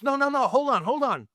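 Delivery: high-pitched, hurried-sounding plea to stay; urgent placating. Retold or imitated. Director stopping the actor as he gets up, urgent placating. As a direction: imitate someone stopping a guest from walking out.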